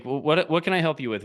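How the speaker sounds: normal talking voice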